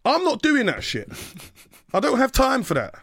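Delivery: high, scornful brush-off; mock scornful refusal; dismissive. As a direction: wave it off with contempt.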